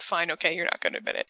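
retold grudging surrender, hushed; imitated resignation. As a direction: concede under your breath. Retold husband gives up arguing.

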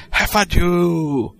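slow, drawn-out mock yell. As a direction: yell it like the theme's opening shout.

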